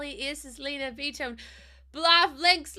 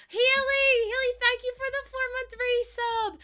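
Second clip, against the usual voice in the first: excited thanks; 8 semitones higher than usual. Real, not acted.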